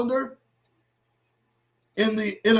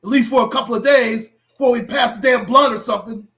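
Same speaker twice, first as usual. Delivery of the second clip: loud, higher, shouted appeal; angry shout; 4 semitones higher, 6 dB louder than usual. Real, not acted. Vlogger shouting at viewers to get back on track. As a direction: yell at them to focus.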